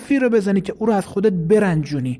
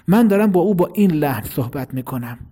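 slightly raised voice. Shown as a demonstration. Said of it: "صدام از صدای او رفت بالاتر". (صدای کمی بالاتر، بدون داد)

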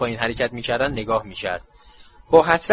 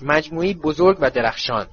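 usual speaking voice

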